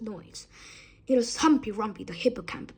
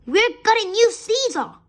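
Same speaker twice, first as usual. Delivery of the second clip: put-on high, thrilled child voice; excited shout; 10 semitones higher, 4 dB louder than usual. A narrator's reading. Children shouting excitedly about the new seesaw. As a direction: shout it like a delighted kid. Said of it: "shouting with excitement".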